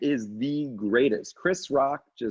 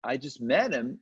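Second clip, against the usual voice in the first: ordinary talk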